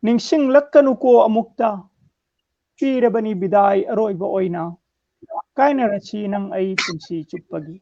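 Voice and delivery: measured declamation in separate phrases; strong line openings, falling cadence; poem recitation. Acted. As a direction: recite verse line by line with pauses. Recites own poem on request.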